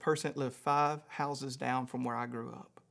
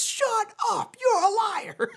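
high-pitched, louder mock accusation; astonished disbelief; 5 dB louder, slower than usual. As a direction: imitate a man who cannot believe the coincidence. Homeless man's retold disbelief.

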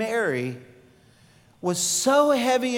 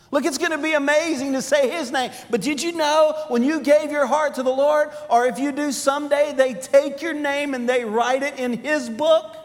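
excited preaching; 5 semitones higher than usual. Acted. Pastor stirs the congregation about heaven, excited. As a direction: lift the room toward heaven's promise.

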